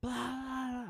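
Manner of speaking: high drawn-out gibberish wail; mock scream. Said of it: "I don't even remember what she was screaming, but she was screaming".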